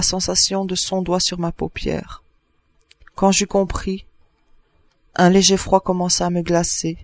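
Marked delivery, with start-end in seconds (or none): none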